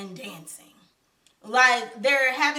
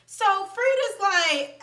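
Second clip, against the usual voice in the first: normal talking voice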